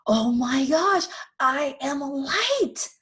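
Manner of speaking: high, slow amazed gasp; awed discovery. Acted. Little soul realizes it is light, high slow awe. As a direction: let the wonder land slowly.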